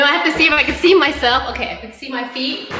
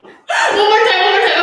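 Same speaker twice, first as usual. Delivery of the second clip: psyching up; 7 semitones higher, 8 dB louder than usual. Real, not acted. Chants to psych herself up.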